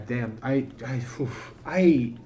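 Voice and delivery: normal talking voice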